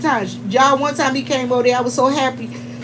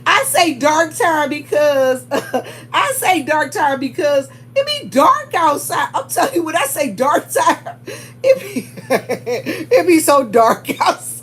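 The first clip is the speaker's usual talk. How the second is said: laughing; 3 semitones higher, slower than usual. Real, not acted. Laughing over own phrase.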